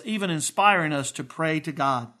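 ordinary talk, nothing marked

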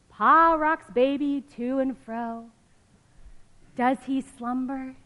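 lively storybook reading voice; reading to children. Acted. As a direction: read it like a picture book for kids. Reading picture book aloud.

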